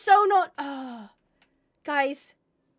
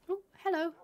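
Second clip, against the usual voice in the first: ordinary talk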